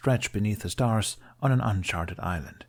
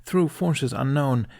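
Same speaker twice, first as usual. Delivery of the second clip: ordinary delivery, nothing marked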